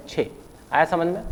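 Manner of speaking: ordinary talk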